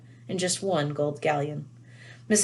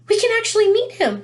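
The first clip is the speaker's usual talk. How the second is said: put-on far higher and louder girlish squeal of excitement; 8 dB louder than usual. A narrator's reading. Schoolgirl thrilled to meet her favourite author, squealing high. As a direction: squeal it like an overjoyed fan. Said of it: "Hermione squealed".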